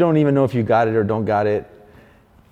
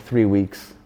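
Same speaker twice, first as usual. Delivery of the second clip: ordinary talk, nothing marked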